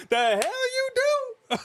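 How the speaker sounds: very high, slow mock squeal; Queen Charmelle's outrage. Retelling Queen Charmelle's reaction, high slow mock squeal. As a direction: stretch it out in a shrill put-on wife voice. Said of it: "Queen Charmelle's like".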